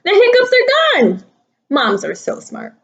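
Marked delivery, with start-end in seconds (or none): 0.0-1.2 high, fast cheerful announcement; a happy high exclamation, faster; excited. Acted. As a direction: burst out with glad relief.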